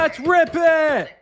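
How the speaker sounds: high, loud hyped cheer; excited. Real, not acted. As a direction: cheer it like a win. Hype over a good offer.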